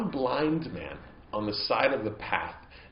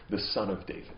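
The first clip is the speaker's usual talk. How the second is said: ordinary talk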